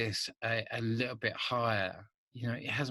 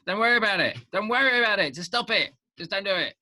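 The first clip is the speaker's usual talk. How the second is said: loud, high, emphatic repeat; emphatic shout; 9 semitones higher, 8 dB louder, faster than usual. Acted. Urging writers loudly not to worry, emphatic shout. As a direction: hammer it home loudly.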